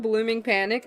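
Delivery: normal talking voice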